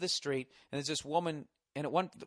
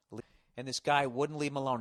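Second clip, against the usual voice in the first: ordinary delivery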